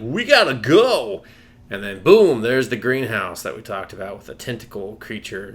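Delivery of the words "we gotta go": slow, loud, urgent "We gotta go"; urgent shout. Retold or imitated. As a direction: yell it out, dragging it slowly. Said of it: "He's like".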